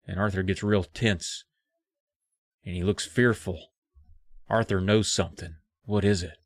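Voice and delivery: ordinary talk, nothing marked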